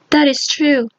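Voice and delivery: ordinary delivery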